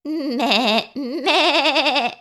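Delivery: high, juddering goat bleat; long quavering bleat, second one longer. A narrator's reading. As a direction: imitate a goat with a rapid wobble. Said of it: "said the goat".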